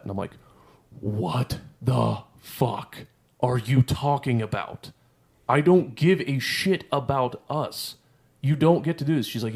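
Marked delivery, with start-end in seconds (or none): 1.0-9.3 slow, heavily spaced words, building higher and louder; retold indignant outburst. Soldier rebuking subordinate over their history, indignant. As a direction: re-enact outrage, drawing out each word.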